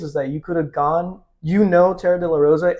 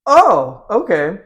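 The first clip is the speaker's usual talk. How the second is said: surprised; 5 semitones higher, 7 dB louder, much slower than usual. Retold or imitated. Reaction to brown belt news.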